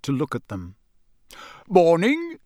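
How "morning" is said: bright, higher, with a rising lift; bright greeting. A narrator's reading. Mister Weasley greeting the Muggle, brightly. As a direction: greet brightly, lifting the end. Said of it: "said Mister Weasley brightly".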